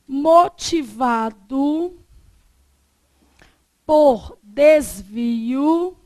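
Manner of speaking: slow, loud dictation; dictating. Real, not acted. Teacher dictating a definition to the class, slow and loud. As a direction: dictate firmly, stretching syllables with long pauses.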